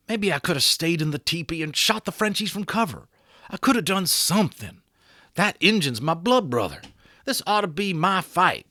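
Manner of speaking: a cowboy insisting he should have fought; Boone the cowboy. Acted. Boone voiced by narrator.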